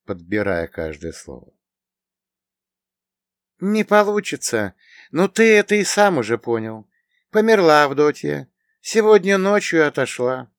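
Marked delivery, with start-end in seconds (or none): 3.6-10.5 put-on high-pitched, plaintive young woman; sad girl. A narrator's reading. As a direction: break the sad news in a raised girlish voice. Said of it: "грустно произнесла девица". (грустный, высокий девичий голос)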